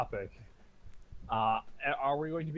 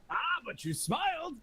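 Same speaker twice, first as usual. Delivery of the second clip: high teasing voice. Acted. Player character teasing halfling.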